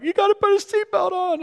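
put-on high-pitched, loud kid's yell; panicked child. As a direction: yell it like a frantic little boy. Acts out frantic child in story.

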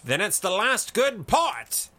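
loud, high shout; shouted toast. A narrator's reading. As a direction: shout it back to the whole table. Shouted pub toast continues.

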